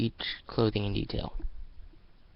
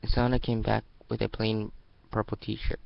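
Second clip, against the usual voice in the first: ordinary delivery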